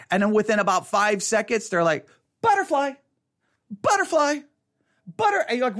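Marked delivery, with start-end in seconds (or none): 2.4-5.5 put-on high, drifting kid voice calling butterfly; a kid wandering off after a butterfly; distracted kid. Distracted kid chasing a butterfly, high and dreamy.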